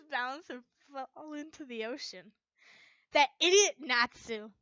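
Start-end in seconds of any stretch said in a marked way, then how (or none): 3.1-4.5 loud, exasperated outburst; loud fed-up cry at a friend; exasperated shout. Acted. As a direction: yell it at the fool. Narrator exasperated at Natsu.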